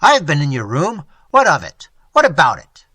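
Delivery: very loud challenge; excited defiance. A narrator's reading. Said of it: "replied Quint excitedly".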